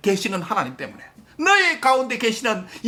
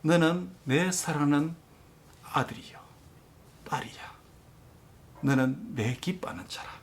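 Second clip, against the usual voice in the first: tender whisper of God; 9 semitones lower, 9 dB quieter than usual. Acted. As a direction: whisper God's love gently. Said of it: "오늘 이 보이스를 들었어요". (하나님의 부드러운 속삭임)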